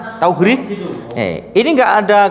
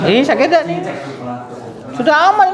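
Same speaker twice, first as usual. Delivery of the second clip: teasing check; 14 semitones higher, faster than usual. Real, not acted. Therapist checks patient's pain.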